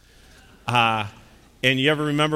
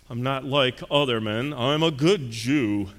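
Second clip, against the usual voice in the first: self-satisfied, drawn-out drawl; haughty well-to-do man praising himself; presuming rich guest; slower than usual. Acted. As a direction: end slowly, savoring his own virtue. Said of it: "this man kind of was presuming".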